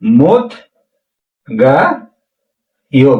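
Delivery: usual speaking voice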